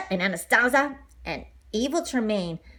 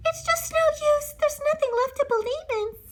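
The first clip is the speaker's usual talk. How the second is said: sobbing. Acted. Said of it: "Cinderella sobbed".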